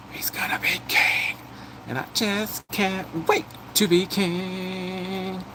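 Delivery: slow breathy whisper; excited whisper. Retold or imitated. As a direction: hush it like a thrilling secret.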